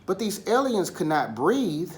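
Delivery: usual speaking voice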